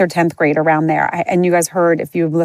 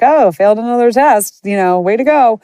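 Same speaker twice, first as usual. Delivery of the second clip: higher, louder mock-cheery self-jab; jokester; 5 semitones higher, 4 dB louder than usual. Class jokester shrugging off a failed test, mock-cheery. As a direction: laugh off a failed test sarcastically. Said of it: "say things like".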